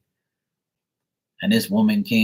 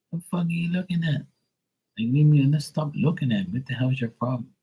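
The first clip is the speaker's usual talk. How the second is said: high, fast irritated challenge to starers; mock confrontation; 7 semitones higher, faster than usual. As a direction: bark it at the strangers, fed up. Confronting starers, sister objecting.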